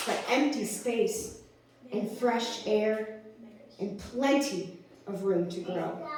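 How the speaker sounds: slow, separated phrases; unhurried spoken-word list; spacious poetry delivery. Acted. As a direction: let each phrase hang before the next. Recites own slam poem.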